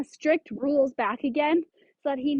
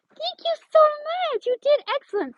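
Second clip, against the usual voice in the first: high-pitched praise; 13 semitones higher than usual. Real, not acted. Trainer thanking her dog in a high pitch. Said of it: "I give him praise at that high pitch".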